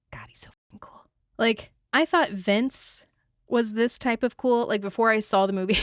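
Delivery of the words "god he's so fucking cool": awed whisper under the breath; whispered admiration. Acted. Adults secretly admiring Jules, in a whisper.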